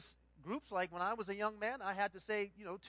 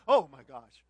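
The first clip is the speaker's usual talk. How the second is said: mock exclaim; 7 semitones lower, 19 dB louder than usual. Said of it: "open it up and go".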